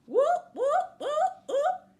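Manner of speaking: high, loud rising whoops; vocal siren sound effect; imitated alarm siren. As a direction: whoop like an alarm, sweeping up each time.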